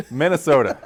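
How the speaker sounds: ordinary talk, nothing marked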